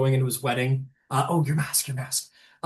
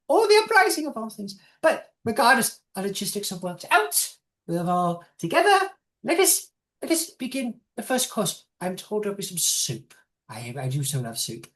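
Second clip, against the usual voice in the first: put-on much higher, dithering old gnome lady; a fluttery, slightly senile old matriarch; old gnome woman; 4 semitones higher than usual. GM voices the matriarch.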